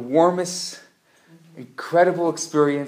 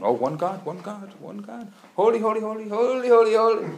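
eager angels; 5 semitones higher, 3 dB louder than usual. Retold or imitated.

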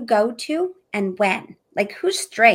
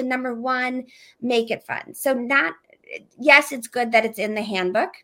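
ordinary delivery

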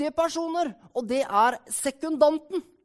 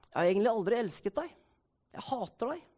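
low, quiet hurtful confession; understated acted bitterness; cold rejection; 3 semitones lower, 6 dB quieter, faster than usual. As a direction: say it softly, dropping almost away. Acted examples of wounding words.

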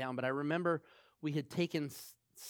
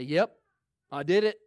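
blunt sinner; 4 dB louder than usual. Retold or imitated. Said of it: "somebody that's a sinner say".

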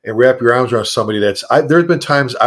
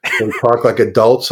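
calm scolding. Retold or imitated. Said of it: "He go".

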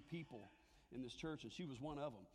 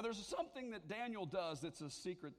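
ordinary talk

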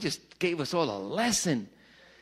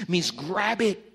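ordinary talk

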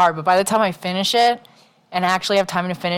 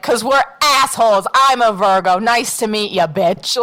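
sassy retort; 3 semitones higher, 4 dB louder than usual. Retold or imitated. Retold sassy reply to client.

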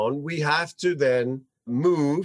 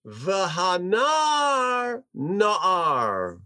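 slow read; much slower than usual. Shown as a demonstration. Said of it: "Now look at those next two words".

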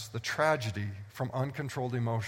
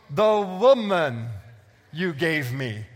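loud, slow, drawn-out mock whine on "The woman"; hammed-up Adam passing the blame; preacher acting out Adam shifting the blame to the woman; Adam blaming; 8 dB louder, slower than usual. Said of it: "He goes".

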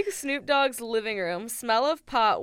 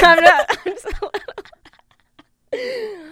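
put-on laughing British accent, pitched up; 10 semitones higher, 14 dB louder than usual. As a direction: squeal it in a silly British voice. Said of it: "gonna try to be British".

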